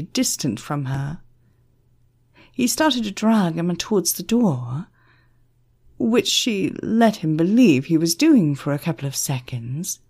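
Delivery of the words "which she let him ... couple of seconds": usual speaking voice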